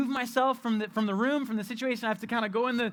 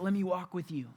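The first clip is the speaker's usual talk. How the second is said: tender hush; 4 semitones lower, 4 dB quieter than usual. Acted. Tender soft repeat of comfort.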